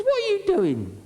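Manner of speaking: hoge vrouwenstem in verontwaardiging; shrill outraged woman. Acted. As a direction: gil het verontwaardigd uit als de vrouw. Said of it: "She says".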